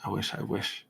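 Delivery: softly whispered longing; whispered wish. Real, not acted. Wishful aside.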